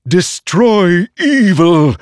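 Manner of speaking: high, loud, very slow bellow; a blade roaring for evil to die; sword's battle cry. A narrator's reading. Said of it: "Nightblood cried".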